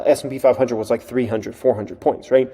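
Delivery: usual speaking voice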